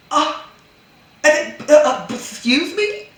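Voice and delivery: high stammering excuse me; indignant disbelief. Real, not acted. Reacting to the husband's property claim, high outraged sputter.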